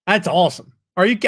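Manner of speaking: ordinary delivery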